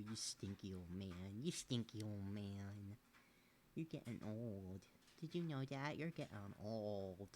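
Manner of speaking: low slow mock-gruff coo; low teasing pet talk. Real, not acted. Teasing old cat.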